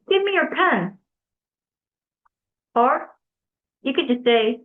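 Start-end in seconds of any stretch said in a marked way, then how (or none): 0.1-1.0 higher-pitched blunt command; impolite demand. Shown as a demonstration. Teacher showing students how not to ask, blunt demand. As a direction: demand it without any politeness. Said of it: "don't just say".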